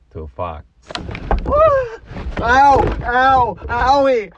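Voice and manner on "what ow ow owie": sharp high yelps of pain, loud. Real, not acted. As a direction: cry out high as each punch lands. Friend punches him in car.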